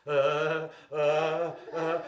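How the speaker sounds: loud, repeated shuddering uhs; frightened shaking grunts. A narrator's reading. Storyteller as pirate facing the bag, loud scared grunts. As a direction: shudder out loud at the scary thing. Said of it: "he started to shake".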